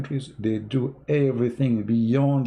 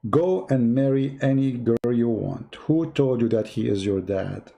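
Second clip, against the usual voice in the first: slow punchline; slower than usual. Retold or imitated. Mother's punchline to son.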